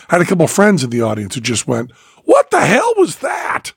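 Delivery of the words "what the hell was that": stunned exclamation. Retold or imitated. Friends in the audience reacting to the show, stunned.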